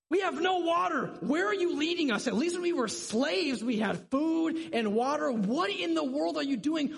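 high, loud, exasperated protest; unhappy outburst. Acted. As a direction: confront your leader in loud, high-pitched disbelief. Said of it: "They're not happy".

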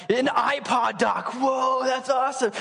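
high, drawn-out hype; mock amazement. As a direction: gush over a prize, hammed up. Acting hype over the prize.

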